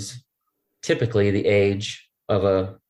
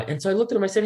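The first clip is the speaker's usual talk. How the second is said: usual speaking voice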